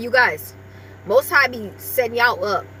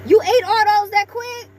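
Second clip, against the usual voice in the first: higher, mock-shocked question to the dog; surprised pet talk; 8 semitones higher than usual. Real, not acted. Owner to her dog that gulped the treats, mock surprise. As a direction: pretend to be amazed. Scolding dog for eating fast.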